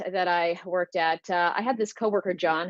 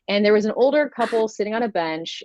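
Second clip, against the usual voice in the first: normal talking voice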